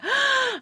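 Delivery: sharp high gasp; excited gasp. Retold or imitated. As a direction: gasp in delight. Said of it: "And doing this".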